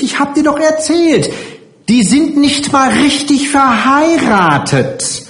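very high, insincerely urgent pleading; falsch eindringlich. A narrator's reading. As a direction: press the child with false concern, high-pitched. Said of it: "Sie sprach so falsch eindringlich in das Kind hinein". (heuchlerisch eindringlich, mit sehr hoher Stimme)

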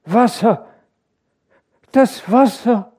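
high, feverish whimpering cry. A narrator's reading. Feverish wife cries about the water, whimpering. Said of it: "wimmerte die Kranke".

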